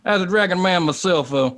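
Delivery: folksy Texan dragon-man voice; dragon man in character. Acted. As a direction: play a courteous Texan dragon doctor. Answers as his Texan dragon man.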